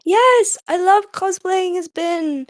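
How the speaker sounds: high, loud delighted yes, stretched out; excited. Real, not acted. Delighted reply about cosplaying together.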